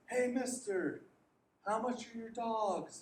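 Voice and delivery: put-on eager child's call; little boy calling. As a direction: play the small boy shouting across the yard. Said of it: "he yells".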